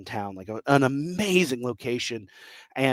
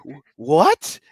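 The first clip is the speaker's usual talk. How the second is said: a much higher, louder baffled what; sudden startled what, pitched way up; confused what; 5 dB louder than usual. Retold or imitated. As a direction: sound utterly lost. Viewer confused by the gibberish.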